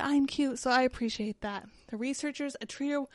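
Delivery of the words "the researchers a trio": winded, running out of air; short of breath. Real, not acted. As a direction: read it while gasping for breath. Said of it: "short of breath, I gotta breathe".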